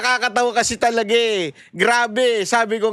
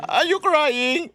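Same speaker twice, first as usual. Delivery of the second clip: slow, drawn-out, higher teasing question; mocking echo; 4 semitones higher, slower than usual. Host echoing the questioner at the witness, mocking echo. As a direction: stretch the question out to tease.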